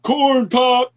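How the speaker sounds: slow, put-on old politician; lightly voiced Biden. Friend rambles in a Biden voice.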